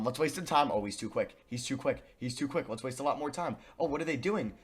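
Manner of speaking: ordinary talk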